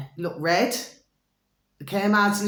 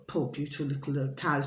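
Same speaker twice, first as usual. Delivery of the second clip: soft pity; 5 semitones lower, 7 dB quieter than usual. Real, not acted. Vlogger lamenting the animals, soft pitying tone. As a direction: lower and softer, pitying.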